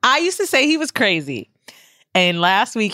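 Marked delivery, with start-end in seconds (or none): none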